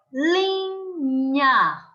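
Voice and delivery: linha split, with a long held LI; stress on penultimate syllable. Shown as a demonstration. Teacher shows the penultimate tonic syllable, li held long in linha. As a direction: stretch the li in linha, then nha. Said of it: "sílaba tônica está localizada".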